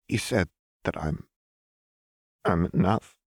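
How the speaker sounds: halting, voice catching on the last word; falter, then crack slightly at the end. A narrator's reading. Jinx answers Lux on the verge of tears, voice cracking. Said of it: "Jinx's voice cracked across the last word".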